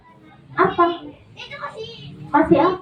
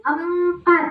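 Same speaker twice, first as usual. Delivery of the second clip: ordinary delivery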